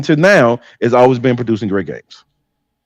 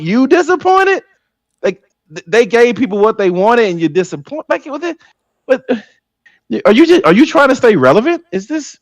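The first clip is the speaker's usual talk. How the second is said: incredulous; 10 semitones higher than usual. Real, not acted. Co-host incredulous at the take.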